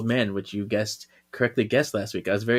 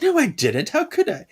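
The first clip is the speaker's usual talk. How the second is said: much higher, squeaky protest of innocence; mock innocent; 14 semitones higher than usual. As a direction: deny it with high mock innocence. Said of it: "And she's like".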